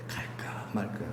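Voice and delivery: breathy hush of indecision; whispered dithering. Pretend. Mimicking the dithering churchgoer, whispered. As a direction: whisper it like a secret doubt.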